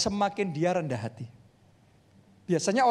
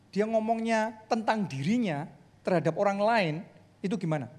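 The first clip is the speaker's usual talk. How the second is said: ordinary talk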